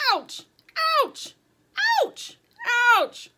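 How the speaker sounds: short, sharp, separate yelps; one quick yelp per painful rip. A narrator's reading. Mustaches ripped off, each chicken.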